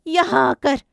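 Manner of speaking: loud, high accusing shout; furious ghost. Acted. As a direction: lash out with a vengeful threat. Possessed daughter turns on father.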